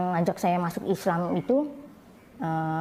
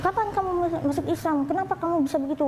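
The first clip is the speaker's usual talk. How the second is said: retold scolding; 10 semitones higher, faster than usual. Mother questioning her child's conversion to Islam, imitated scolding. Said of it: "saya dimarahin terus".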